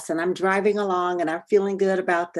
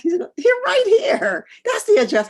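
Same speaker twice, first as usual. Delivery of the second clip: much higher, louder amused reply; amused man; 7 dB louder than usual. Retold or imitated. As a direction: voice the stranger laughing kindly. Man laughs, amused answer.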